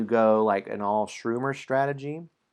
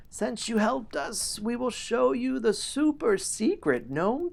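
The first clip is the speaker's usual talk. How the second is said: high-pitched, slow gnome elder voice; a little gnome speaking high and drawn out; 9 semitones higher than usual. A narrator's reading. Gnome elder warning the helper of a dangerous passage, high elder voice. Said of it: "A gnome elder quiets the crowd of happy gnomes and says".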